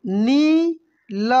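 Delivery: नील stretched very slowly with a long ई; drawn out. Shown as a demonstration. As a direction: hold the vowel of नील long. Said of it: "कैसे पढ़ेंगे?". (नील को खींचकर बोलिए)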